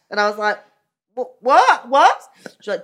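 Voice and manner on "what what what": high, loud stunned whats; disbelief. Retold or imitated. Granddaughter stunned by the nan's phone call, loud. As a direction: keep firing back in disbelief.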